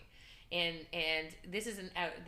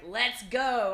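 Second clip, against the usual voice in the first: excited cheer; 4 semitones higher, 10 dB louder than usual. Retold or imitated. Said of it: "I'm like".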